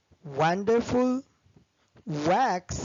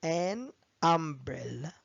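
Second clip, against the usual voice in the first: an umbrella said slowly with an rising; slow model phrase; 3 dB quieter, much slower than usual. Shown as a demonstration. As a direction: stretch out an umbrella. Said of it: "بقول an".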